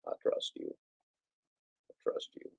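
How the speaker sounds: I trust you breathed in a whisper. Real, not acted. Telling Mary Poppins he trusts her, whispered. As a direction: whisper it softly. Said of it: "repeated in whisper".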